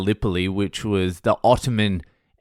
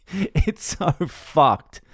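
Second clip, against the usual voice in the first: laughing through it; 6 semitones higher, much slower than usual. Real, not acted. Laughing at the Anzac landing.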